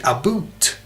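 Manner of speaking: "a boot" for about, with an oo vowel; Canadian pronunciation. Shown as a demonstration. Demonstrating Canadian vowels, "a boot" with a long oo. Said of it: "it's just American".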